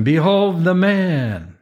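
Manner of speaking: high, slow announcement; grand proclamation. A narrator's reading. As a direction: present him to a crowd, stretching each word high. Pilate presenting Jesus to the crowd.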